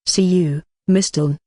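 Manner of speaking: ordinary delivery, nothing marked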